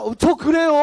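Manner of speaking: high, loud eager agreement; mock someone thrilled to be understood; gullible excitement. Woman agreeing with fortune teller, high mock excitement.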